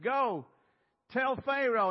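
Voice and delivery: God sending Moses to Pharaoh, loud and high; commanding God. Acted. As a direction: order him off with full force.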